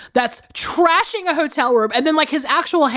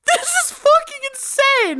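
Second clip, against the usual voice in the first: high-pitched disbelieving squeal; retold reaction, squeaky with amazement; incredulous; 14 semitones higher than usual. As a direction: shoot the voice way up in mock disbelief. Retells own stunned reaction to scene.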